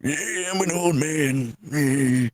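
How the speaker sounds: put-on high, slow, creaky old man. Shown as a demonstration. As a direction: play a frail old grandpa. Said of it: "give me your old man voice".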